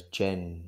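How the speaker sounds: "Jenkins" broken in two, long pause after "Jen"; low, drawn-out "Jenkins" with a gap in the middle; threatening split name. Retold or imitated. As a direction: say "Jenkins" as two menacing halves, Jen ... kins. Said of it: "just sort of separates his name".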